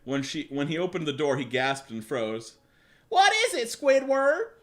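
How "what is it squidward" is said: high, loud cartoon question; very confused. Squirrel asking her frozen friend, high confused shout. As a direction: call it out puzzled, pitched way up. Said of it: "very confused".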